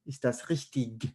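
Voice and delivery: "richtig" closed with a hard -ik; native-speaker variant. Shown as a demonstration. Teacher repeating what many native speakers would say, hard-ending variant. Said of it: "a lot of native speakers of German would say".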